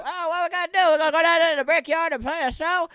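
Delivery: high, loud goofy band-mate voice; band voice impression. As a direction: ham up the dopey band chatter. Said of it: "that's how we talk when we're in the band".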